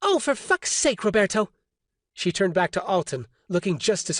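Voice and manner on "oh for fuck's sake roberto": high, exasperated woman's voice. A narrator's reading. Orly scolding Roberto, exasperated woman's voice. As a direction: snap at him in disbelief.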